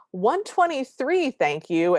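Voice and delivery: high, slow, prim feminine voice; girl's voice. Retold or imitated. Disguised girl blurts out her weight in her girl voice. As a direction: slip into a dainty girly voice. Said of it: "she says in her girl's voice".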